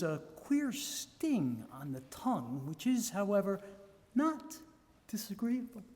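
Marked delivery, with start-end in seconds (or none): none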